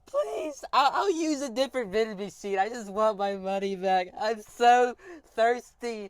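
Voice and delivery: high, loud, slow hammed-up begging; over-the-top whiny pleading for his money; mock crying plea. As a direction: beg the machine like a wailing child. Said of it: "crying and pleading to the vending machine".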